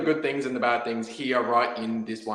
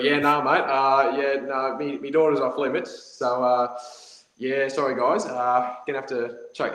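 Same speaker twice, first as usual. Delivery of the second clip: broad Aussie king, pitched higher; hammed-up Aussie accent; 3 semitones higher than usual. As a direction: play up the ocker accent, refusing cheerfully. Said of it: "He was an Aussie king".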